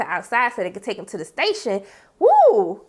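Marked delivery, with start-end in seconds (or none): 2.2-2.8 high, loud excited whoop; excited woo. Real, not acted. Recapper reacts to the hallway scene, loud whoop. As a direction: let out a hype shout.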